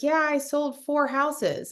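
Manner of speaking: slow, high, self-satisfied brag; proud boast. Retold or imitated. Mimicking a friend boasting about his sales. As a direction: imitate him drawing it out proudly. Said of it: "And he was like".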